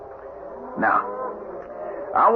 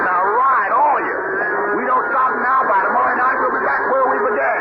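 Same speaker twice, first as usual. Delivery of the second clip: loud commands; 9 semitones higher, 3 dB louder than usual. Acted. Boss orders the men to ride.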